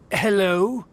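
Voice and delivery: lifts the word in scornful mimicry, rising at the end; mocking echo. Haughty noble mocking a commoner's casual hello. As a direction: parrots the traveler's greeting with disdain. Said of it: "greet me in such an ignoble and vulgar manner".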